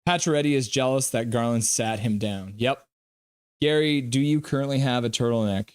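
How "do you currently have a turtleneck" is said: ordinary delivery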